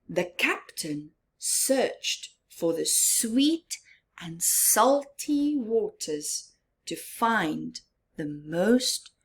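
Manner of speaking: ordinary delivery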